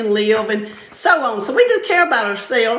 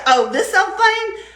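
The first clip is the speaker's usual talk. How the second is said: mock dismissive; 9 semitones higher, slower than usual. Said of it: "You say".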